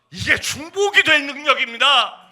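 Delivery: loud, high impassioned preaching; 열정적인 설교. Real, not acted. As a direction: exhort the congregation at full force. Preaching at full force. (힘차게 외치는 설교)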